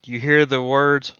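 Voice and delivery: ordinary talk, nothing marked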